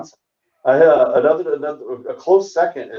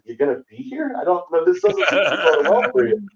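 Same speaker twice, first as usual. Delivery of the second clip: higher, laughing doubt; can't believe she's asking; comic laughing in disbelief at the raided bar's offer; incredulous disbelief; 10 semitones higher than usual. Retold or imitated. Asked to return to raided bar.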